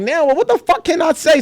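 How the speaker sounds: high-pitched exasperated protest. Retold or imitated. Client fed up with publicist's warnings, exasperated. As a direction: throw up a frustrated question, pitched high.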